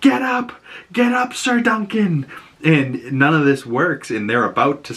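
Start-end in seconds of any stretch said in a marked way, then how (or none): 0.0-2.2 high-pitched, urgent mock yell; imitated yelling. Egg urging fallen Sir Duncan to rise, high mock yelling. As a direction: plead from afar in a high, stretched call. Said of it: "you hear Egg yelling".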